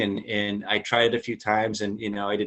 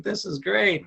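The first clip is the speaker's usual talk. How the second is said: chipper quote; 10 semitones higher, slower than usual. Retold or imitated. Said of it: "I'm like".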